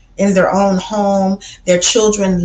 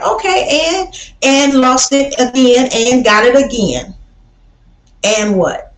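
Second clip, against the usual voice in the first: pitched up, sassy retort; defiant comeback; 4 semitones higher than usual. Real, not acted. Speaking back to online gossips, defiant and sassy. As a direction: brush off the haters.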